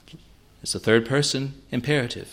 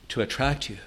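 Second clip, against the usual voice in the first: normal talking voice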